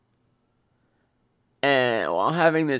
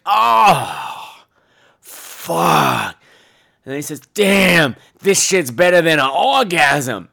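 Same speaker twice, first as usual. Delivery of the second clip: relieved groaning; 3 semitones higher, 6 dB louder, much slower than usual. Retold or imitated. Tin Man relieved at urinal.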